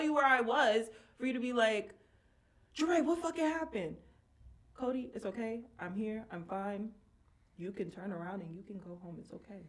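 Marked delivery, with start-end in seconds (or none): 2.7-4.0 alarmed friend, high-pitched; imitated alarm. Friend racing over demands to know what happened, mock-alarmed. Voicing friend rushing to her rescue. 4.7-9.6 soft, settling reassurance; retold reassurance. Telling a friend already driving over to go home, softly reassuring.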